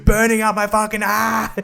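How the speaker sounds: high, loud hammed-up cries of pain; mock pained howling. Imagining how the burnt girl should scream, in hammed-up agony. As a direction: overact a burnt leg, yelling in mock agony. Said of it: "she's just not like".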